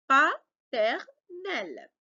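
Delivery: "Paternel" drawn right out, pause after each syllable. Shown as a demonstration. Teacher repeating the word for learners, slow separated syllables. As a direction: sound out Pa-ter-nel very slowly. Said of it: "il faut couper le mot par syllabe".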